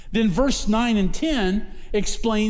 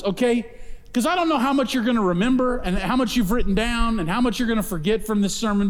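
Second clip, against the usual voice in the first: normal talking voice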